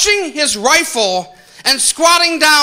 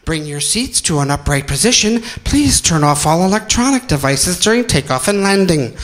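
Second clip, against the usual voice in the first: low, formal cabin announcement voice; flight attendant announcement; 6 semitones lower than usual. Retold or imitated. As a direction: read it like a bored crew member on the intercom. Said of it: "flight attendant... telling you everything".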